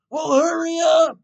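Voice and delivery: high loud shout of hurry up; impatient yell. Acted. Driver behind yells at podcaster, loud shout. Acted drive-thru quarrel.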